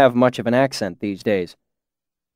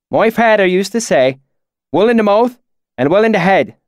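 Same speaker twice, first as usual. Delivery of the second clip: higher, louder fake backwoods dialect; put-on valley accent; 8 semitones higher, 4 dB louder than usual. Narrator poses as a local, put-on valley accent. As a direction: narrator fakes a rural accent to fit in. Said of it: "my sudden change in dialect".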